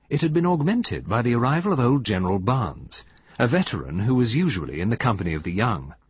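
plain reading voice

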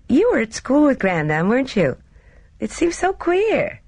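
put-on high, girlish character voice; young Gina, wondering. Gina marvelling at her companion's schooldays, high and wondering. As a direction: lift it high with curious disbelief.

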